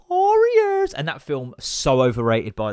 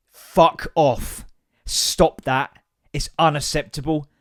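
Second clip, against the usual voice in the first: normal talking voice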